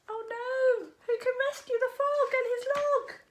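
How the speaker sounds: put-on high, alarmed storyteller asking the kids; mock worry. A narrator's reading. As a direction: sound worried for the frog. Narrator worried for frog.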